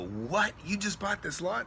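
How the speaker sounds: mildly incredulous, imitating a neighbour; retold surprise. Neighbours to the buyer of the lot, surprised.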